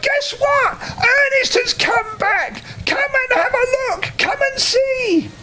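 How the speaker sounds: put-on a child calling her mum in delight; high girl's voice, eagerly calling out; excited little girl. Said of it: "she yelled".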